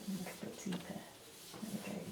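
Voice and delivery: muttered under the breath to herself; softly muttering to herself while she works; muttered aside. Real, not acted. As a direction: mumble it to yourself as you draw.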